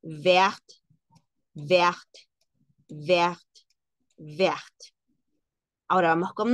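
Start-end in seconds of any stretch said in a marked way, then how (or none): 0.0-4.7 vert with silent t, four times alike; French vert. Shown as a demonstration. Teacher repeats vert for class, French style. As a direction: say vert like vair each time. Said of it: "Suena igual".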